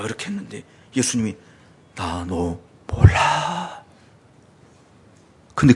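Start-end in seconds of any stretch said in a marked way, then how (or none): 1.9-3.8 very slow, much softer and lower; low slow voice of final rejection; cold rejection. Acted. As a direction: drop it quietly and deliberately. Jesus rejecting them again.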